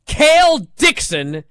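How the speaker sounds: high, loud, drawn-out name; loud, irritated disbelief; exasperated. Real, not acted. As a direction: spit out the name slowly in annoyance. Irritated repeat of the hated name.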